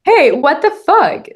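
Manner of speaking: 높고 크게 천천히 "Hey, what the fuck?"을 내지르듯; slow, loud, high burst of outrage; loud slow outburst. Shown as a demonstration. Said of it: "That's even better".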